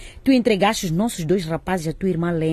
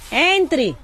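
calling out; 9 semitones higher, 3 dB louder than usual. Acted. Calling visitor in from inside.

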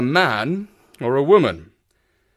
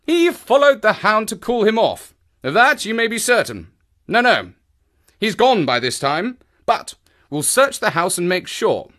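higher, brisk-minded detective dialogue voice; decisive investigator; 10 semitones higher than usual. Acted. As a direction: state it with firm certainty, pitched up. Voices character's dialogue in narration.

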